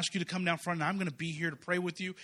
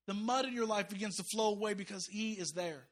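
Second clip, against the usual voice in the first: ordinary talk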